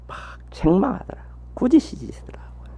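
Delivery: a hushed aside to the congregation; whispered aside. Acted. Sudden hushed aside in sermon.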